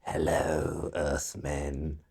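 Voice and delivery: low, soft otherworldly alien greeting; hushed, deep mock-alien voice; expected alien voice. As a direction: intone it like a mysterious alien visitor. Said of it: "of course I'm expecting".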